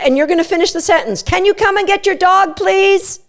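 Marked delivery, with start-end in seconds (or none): 1.2-3.2 high cheery "Can you come and get your dog, please?"; bright lifted ask; excitable cue. Shown as a demonstration. Owner finishing the cue sentence, excitable. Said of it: "say it in an excitable tone".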